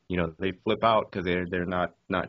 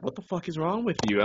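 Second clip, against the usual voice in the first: higher-pitched appalled question; retold disbelief; 7 semitones higher than usual. Canadian passenger questioning the driver, appalled. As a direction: imitate someone aghast at you.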